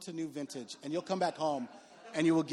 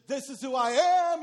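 bold declaration; 6 semitones higher, 7 dB louder than usual. Retold or imitated. Voicing visitors declaring themselves.

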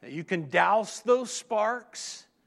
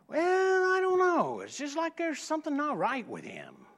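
lightly voiced higher woman; a hint of the puzzled buyer; buyer's voice; 8 semitones higher than usual. Preacher imitating the buyer describing the dog, higher.

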